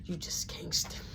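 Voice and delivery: breathless unvoiced whisper; whispered look. Mom silently judging her child, whispered. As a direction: mouth it under the breath. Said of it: "give me this look like".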